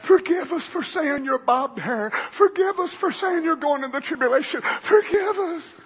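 pleading, voice catching, slowing at the end; tearful mock plea. Preacher voicing a pleading apology, near tears. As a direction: beg for forgiveness with a near-cry.

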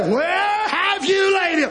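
high, drawn-out cry of a question; preacher's cry. Retold or imitated. Preacher voicing Jesus' long-awaited question, crying out. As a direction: call it out slowly, pitched high.

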